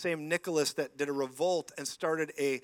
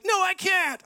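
high, loud wail of protest; mock desperate refusal; 9 dB louder, slower than usual. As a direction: cry it out as the overwhelmed woman. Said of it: "And she's like".

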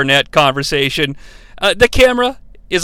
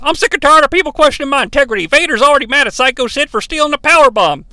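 higher, fast indignant rant; furious rant; 7 semitones higher, faster than usual. Retold or imitated. Manager furious at a caller, a fast higher rant. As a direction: rattle it off offended and fast. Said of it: "Cornette is furious".

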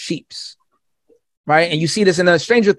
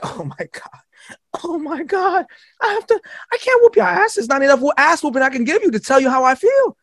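breathy gasp rising into high crying wail; distraught crying parent; 10 semitones higher than usual. Retold or imitated. Parent finding the house burned down, crying high and desperate. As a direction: sob it out, beside yourself.

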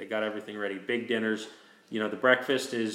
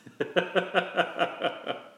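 breathless laugh; 8 semitones higher than usual. Real, not acted. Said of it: "wicked awesome".

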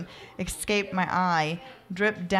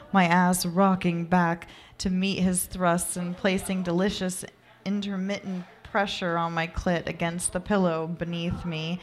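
ordinary delivery, nothing marked

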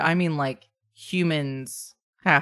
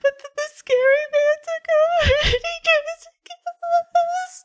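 very high little-kid voice, breaking into acted wails; bawling child. Child telling a stranger about the scary man, bawling child voice. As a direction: stammer it out like a small child in tears. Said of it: "he's now bawling inconsolably".

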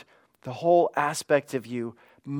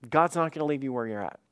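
quicker, pressing the point home; faster repeat; faster than usual. Real, not acted. Pastor pressing the point to his church, faster. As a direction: rush it the second time round.

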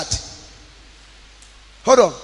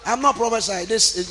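usual speaking voice

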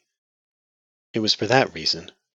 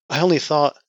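much higher, louder, cut off; timid; 9 semitones higher, 9 dB louder than usual. Acted. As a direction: start timidly and get cut off. Daughter tries to explain.

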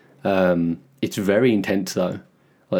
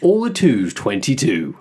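bingo caller; 3 semitones higher, 4 dB louder, slower than usual. Pretend. Imitating bingo caller intonation.

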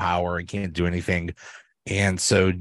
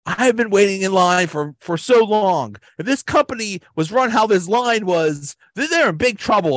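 much higher and louder indignant complaint; imitating Dwight; 10 semitones higher, 4 dB louder than usual. Dwight finally questioning the shareholders, high and indignant. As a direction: huff it out like a self-important nerd, pitched up. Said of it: "He says".

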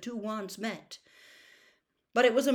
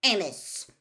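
higher, louder, curt address; 4 semitones higher, 6 dB louder than usual. A narrator's reading. Mister Crouch addressing Amos, curt. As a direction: say the name curtly. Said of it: "said Mister Crouch curtly".

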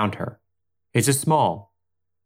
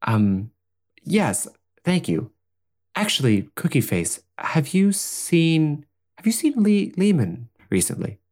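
higher, nervous and hesitant; flustered young woman; 11 semitones higher than usual. Acted. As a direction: stammer like a confused guest. Plays worried Madeline.

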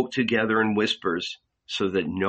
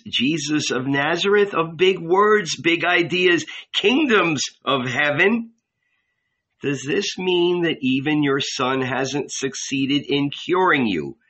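higher and louder, scornful build; mocking scorn; 6 semitones higher, 3 dB louder than usual. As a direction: scoff at the big words, rising. Angel mocking the big words.

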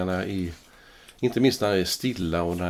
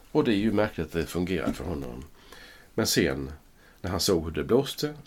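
ordinary talk, nothing marked

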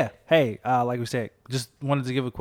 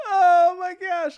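laughing; 8 dB louder, much slower than usual. Real, not acted. Laughing fit after joke.

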